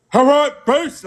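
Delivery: high, loud barked command; mock Marine sergeant order; drill sergeant bark.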